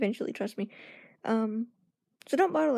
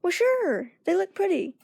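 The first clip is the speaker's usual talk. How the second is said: bright higher voice, playing along; high, polite fake approval; 7 semitones higher, slower than usual. To sister's friend about her nails, brightly playing along. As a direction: sound upbeat and agreeable, a bit unsure. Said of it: "I was like".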